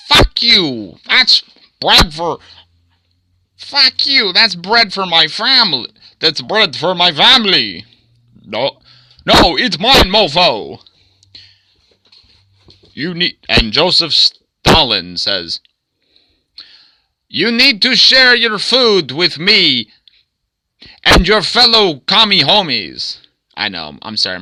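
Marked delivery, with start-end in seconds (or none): 0.1-7.9 high-pitched, hammed-up angry shouting; acted quarrel. One of two men fighting over a loaf of bread, angry shouting. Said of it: "fighting over a loaf of bread". 8.4-10.8 high, loud mock-angry retort; acted quarrel. Second man fighting over a loaf of bread, angry retort. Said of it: "fighting over a loaf of bread". 17.3-23.2 high, loud, badly faked Russian accent; terrible Russian accent. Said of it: "my Russian accent is fucking terrible".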